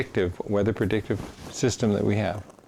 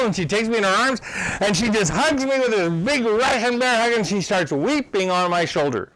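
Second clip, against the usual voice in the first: high, loud, caught up in the memory; moved retelling; 12 semitones higher, 4 dB louder than usual. Real, not acted. The speaker recalls a grateful woman weeping on him, high and moved. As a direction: tell the hug as if you feel it still.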